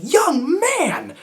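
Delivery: put-on high, sharp call of young man; lady catching a kid at the candy bowl; stern shout. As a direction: scold a child from down the hall.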